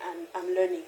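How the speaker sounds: quiet, loving reassurance; tender apology to unborn baby. Retold or imitated. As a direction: speak softly to the baby you carry as if in your hands. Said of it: "lay my hands on my five weeks or six weeks pregnancy and say".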